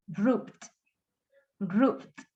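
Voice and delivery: one-syllable "Roofed", F straight into the final stop; low, deliberate "Roofed" without extra syllable; silent E ending. Shown as a demonstration. Teacher models not sounding E D, clipped onto final consonant. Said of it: "No hacemos sonar esa, esa E D".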